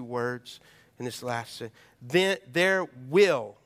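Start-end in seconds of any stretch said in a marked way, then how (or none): 2.0-3.6 slow, emphatic "will"; stress on will. Shown as a demonstration. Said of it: "underline that word will".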